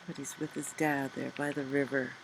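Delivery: softly, dropping the voice low in level; a quiet, hushed read of "by the river"; hushed storyteller; soft narration. A narrator's reading. Quiet reflective story moment.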